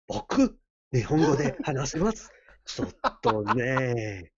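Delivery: halting, drawn-out mock-serious Japanese; jokey Japanese. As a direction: improvise Japanese with long hesitations. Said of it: "to talk in Japanese, please".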